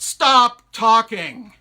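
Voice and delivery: huge, slow bellow; thunderous drawn-out command; booming well voice. A narrator's reading. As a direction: roar it out, stretching each word. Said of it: "the voice in the well said".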